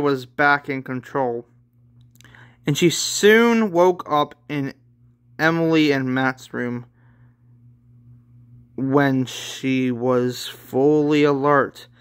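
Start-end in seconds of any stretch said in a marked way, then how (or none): none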